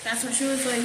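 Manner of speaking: ordinary talk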